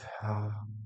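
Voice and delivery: long soft calming sigh; soft exhale. Acted. Dad and daughter breathing together, soft sigh. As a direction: breathe out slowly and softly. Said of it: "They breathe".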